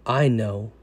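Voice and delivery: soft, low and calm; gentle reply. Retold or imitated. Jesus gently reassuring the anxious sinner, soft and low. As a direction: answer quietly, with tender reassurance.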